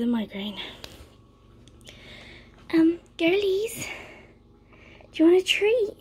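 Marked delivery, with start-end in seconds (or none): 2.7-3.9 high, sing-song voice to the dogs. Real, not acted. Owner coos at her dogs over treats, high and sing-song. 5.1-6.0 excited dog talk. Real, not acted. Asking dogs, first take.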